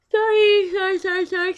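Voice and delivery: high, loud flurry of sorries; squealed apology. Real, not acted. Interrupting her own reading to apologize, high squeal.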